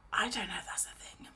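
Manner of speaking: whisper. Real, not acted. Whispered aside.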